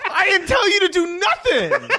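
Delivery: shrieking with laughter, outraged; laughing yell, high pitched; laughing shout. Real, not acted. Mock outrage at sabotage joke.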